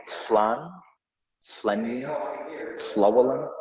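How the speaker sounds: "slan", "slenni", "slowelin" starting with a blown sideways L; "slan", "slenni", "slowelin" with a voiceless hissed LL for "sl"; Welsh double L. Shown as a demonstration. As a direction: blow air past the tongue to open "slan", "slenni", "slowelin". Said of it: "Welsh words that have the sound in it".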